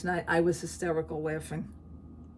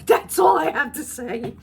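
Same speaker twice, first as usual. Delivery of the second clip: high squealing laugh; 8 semitones higher, 9 dB louder than usual. Real, not acted. Laughing about lottery fund.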